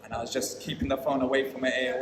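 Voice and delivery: normal talking voice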